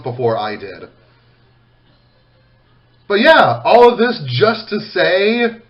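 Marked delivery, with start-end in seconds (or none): none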